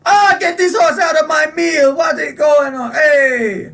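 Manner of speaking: high, loud, furious shopkeeper yelling; outraged shout. Acted. Shopkeeper shouting at the horse eating his dinner, outraged. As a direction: scream at the horse eating your meal. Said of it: "He goes".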